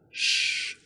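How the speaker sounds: "sh" held alone as a soft hush; sh sound. Shown as a demonstration. Teacher showing S followed by H, "sh" as a hushed sound. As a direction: say "sh" as a long unvoiced shhh. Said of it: "a sh sound".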